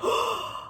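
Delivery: sharp breathless gasp of shock. Real, not acted. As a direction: gasp in real surprise. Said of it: "now that was shocking".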